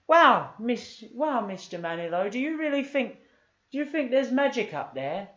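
put-on high-pitched wide-eyed kid; high child voice. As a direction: squeak it like an awed little girl. Noni voiced in story.

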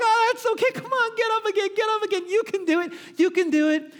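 put-on very high, sing-song cheering on a toddler; encouraging baby talk. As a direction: lift the baby's spirits after a fall. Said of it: "You're like".

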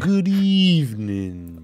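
slow, drawn-out vampire greeting; Dracula voice. Pretend. Co-host answering with a hammed-up Dracula greeting. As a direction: ooze it like a count from Transylvania. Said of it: "that was my Italian and my Romanian".

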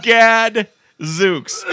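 laughing repeat. Retold or imitated. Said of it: "he goes".

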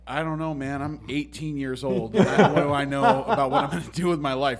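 chuckling excuse to the boss; laughing protest. Retold or imitated. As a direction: laugh through it like a clueless teen. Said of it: "I was like".

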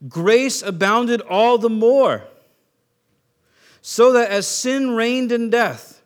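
loud, slow, emphatic scripture reading; big, measured pulpit proclamation; preaching scripture. Real, not acted. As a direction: proclaim the verse slowly and forcefully. Reads scripture at full force.

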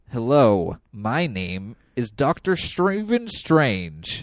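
slow, stiff fake American accent; a Brit straining at an American accent; British actor's American accent. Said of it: "my impression of his American accent".

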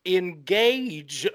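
drawn-out, theatrical "engaged"; Meryl. Retold or imitated. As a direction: stretch out "engaged" as a quoted catchphrase. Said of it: "I have one note under Meryl".

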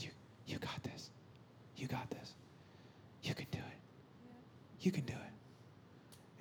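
very soft, unhurried whisper; barely audible imitated voice of God; whispered encouragement. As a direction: whisper it as a loving father, pausing between phrases.

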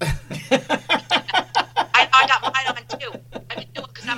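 talking through real laughter; laughing. Real, not acted. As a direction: giggle as you answer. Laughing after Richard joke.

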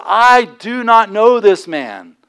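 slow, loud, emphatic disowning; vehement denial. Retold or imitated. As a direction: deny him forcefully, word by word. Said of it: "he begins to swear a curse at them".

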